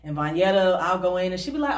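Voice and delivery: ordinary talk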